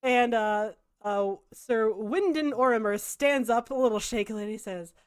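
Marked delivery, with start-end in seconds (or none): none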